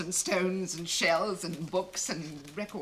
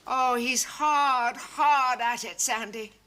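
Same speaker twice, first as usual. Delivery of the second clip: slow covering; 5 dB louder, slower than usual. Acted. Covering on phone for him.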